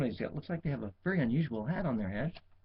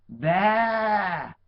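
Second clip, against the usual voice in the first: louder, higher mock bleat; protesting sheep; 5 semitones higher, 7 dB louder than usual. A narrator's reading. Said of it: "the sheep protested".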